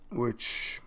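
ordinary talk, nothing marked

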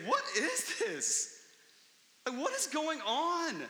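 slow, high, dumbfounded question; astonished. Retold or imitated. Crowd watching Jesus cast out a demon, stunned and amazed. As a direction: ask it like you can't believe your eyes. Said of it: "They're astonished".

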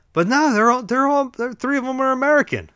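high, loud incredulous outburst; shocked disbelief. Real, not acted. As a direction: burst out in amazement, pitch way up. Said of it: "That's shocking".

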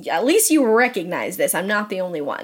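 ordinary delivery

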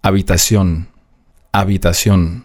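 Habitación starting straight on the vowel a; silent H. Shown as a demonstration. Teacher demonstrating the mute letter, Habitación with silent H. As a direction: say Habitación as "abitasjon", no h. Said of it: "es wird nicht mitgesprochen".

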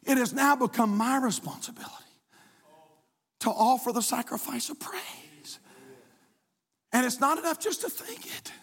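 breathy hushed whisper. Acted. Pastor whispering to the congregation. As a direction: lean in and whisper it. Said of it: "unvoiced whisper".

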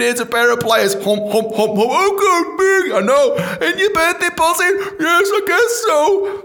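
loud, high hammed-up sketch voices; over-the-top, shrieky mock dialogue; mock birthday scene. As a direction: shout out the absurd scene at high pitch. Acted birthday present scene.

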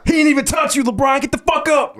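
high, loud heckle at LeBron; angry fan shout. Pretend. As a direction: scream at the player from the couch. Yelling at a player on TV.

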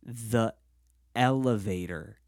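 "The", a pause, then drawn-out "elevator"; slow, separated words. Acted. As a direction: spell out "The elevator" word by word, very slowly.

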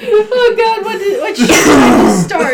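high laughing exclamation. Real, not acted. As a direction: laugh in disbelief. GM laughs at the argument.

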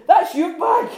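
high, loud cry of disbelief; mock exasperation. Hobbit turning to his dazed friend, high and loud. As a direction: call it out at a muddled friend.

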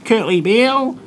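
lightly mocking voice of a chatty rugby fan; pushy rugby fan. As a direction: imitate an eager waiter who won't stop talking rugby. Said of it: "he's like".

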